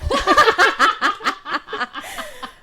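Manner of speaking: high, loud burst of laughter. Real, not acted. Laughing at accent remark.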